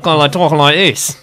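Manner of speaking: Australian as Cockney-Irish blend; blended Aussie accent. Shown as a demonstration. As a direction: exaggerate the Australian twang. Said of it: "you blend that".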